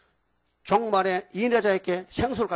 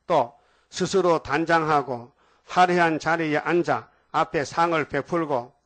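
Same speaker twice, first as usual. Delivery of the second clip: slow, louder solemn reading of scripture; 5 dB louder, slower than usual. Acted. Preacher reading the verse to his congregation, slow and solemn.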